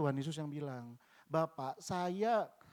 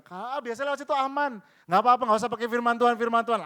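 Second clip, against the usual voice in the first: higher, much louder, fast brushing-off voice; mock tempting voice; 7 semitones higher, 11 dB louder, faster than usual. Preacher voicing the careless inner voice, high and loud. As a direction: wave off the warning carelessly.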